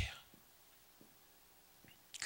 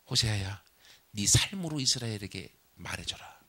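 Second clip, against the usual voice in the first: low, soft, loving speech; tender God voice; 6 semitones lower, 4 dB quieter than usual. Acted. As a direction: speak lovingly, lower and softer. God explains his love. (낮고 부드럽게 사랑을 전하는 하나님)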